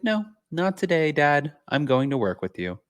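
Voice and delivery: high-pitched cat voice, easing down; pet talking back. Retold or imitated. Pet visitor refusing dad's shooing, high playful voice. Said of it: "she's like".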